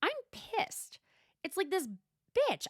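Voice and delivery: high, squeaky mock Gina; a high, squeaky mock of an outraged friend; imitating Gina. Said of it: "Gina says".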